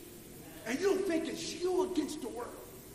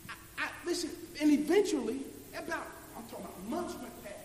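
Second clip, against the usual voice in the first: ordinary talk, nothing marked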